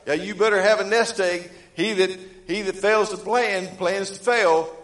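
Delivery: a sly devil's pushy mockery; mocking devil. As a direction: sneer it like the devil whispering doubt. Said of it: "The devil said".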